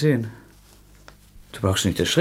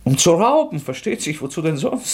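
bitter sarcasm; 7 semitones higher, 3 dB louder than usual. Acted. Bitter sarcastic confession to Sonja.

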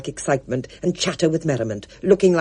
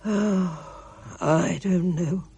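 very slow, sighing gloom; gloomy Mister Ackley; 3 semitones higher, 5 dB quieter, much slower than usual. A narrator's reading. Mister Ackley answers Spud, very slow and gloomy. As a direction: let the pessimism ooze. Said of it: "Pessimism oozed out of Mister Ackley".

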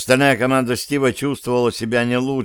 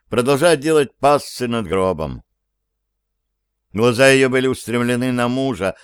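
normal talking voice

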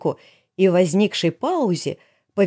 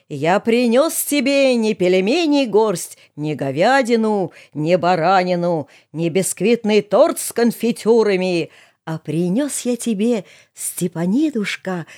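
drawn-out sing-song declamation; mock folk bard; slower than usual. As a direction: stretch each line like a folk ballad. Герой декламирует шуточные стихи. (нараспев, с шутливым пафосом)

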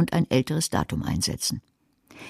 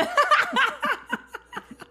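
a real, high, rising fit of giggles. Real, not acted. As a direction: laugh it off. Laughs at her failed impression. (hohes, ansteigendes Kichern)

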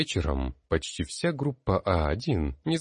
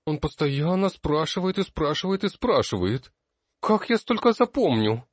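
wailing complaint; 10 semitones higher, 4 dB louder than usual. A narrator's reading. Girl keeps wailing in despair.

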